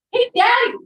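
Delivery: mock innocent little boy; cheeky child. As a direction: call up to dad with a cheeky question. Child's cheeky question to father.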